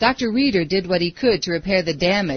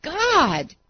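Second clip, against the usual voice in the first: mild scream; 9 semitones higher than usual. A narrator's reading. Falling boy cries to God, high restrained scream. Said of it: "he screamed".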